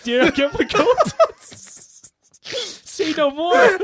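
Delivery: high, laughing dutiful husband; laughing mock husband. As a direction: pitch up and laugh through it. Acting husband answering the call.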